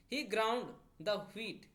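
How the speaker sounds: each word slow and separate; very slow teacher's example read; slow dictation read. Shown as a demonstration. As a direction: read it out slowly for the class. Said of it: "तो देखिए, ये past tense में है".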